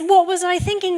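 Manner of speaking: P=A; exasperated. Real, not acted. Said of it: "I mean, yes".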